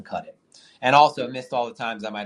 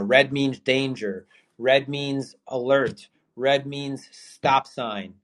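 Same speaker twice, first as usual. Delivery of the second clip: slow and emphatic; much slower than usual. Acted. Hammering home red means danger.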